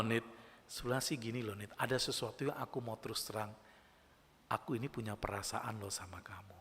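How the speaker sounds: put-on softly confiding to his girlfriend, dropping to a whisper; gentle confession. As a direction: ease into it, hushed and sincere. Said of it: "ngomongnya baik-baik ya".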